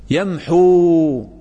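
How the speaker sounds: ويمحو stretched very slowly; teacher stretching the word for the class; drawn-out demo. Shown as a demonstration. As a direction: drag out يمحو syllable by syllable. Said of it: "مرفوع بماذا".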